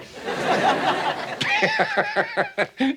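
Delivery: very high, hammed-up villain cackle; mock evil laugh. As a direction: cackle at your own spooky tale. Joke about the evil tonsil.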